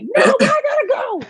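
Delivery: mock tough girl. Narrator mocking her own bravado at the gas station, laughing. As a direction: play it up as fake toughness, laughing. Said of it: "me thinking I'm tough".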